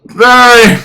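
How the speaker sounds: put-on high, loud drunk yell; drunken shout. Drunk man answering.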